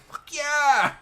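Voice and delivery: triumphant yell, high and loud. Retold or imitated. Poker fan yelling at the TV as players win big flips, yelling out. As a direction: cheer it out like watching a big win. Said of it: "I'm just yelling out like".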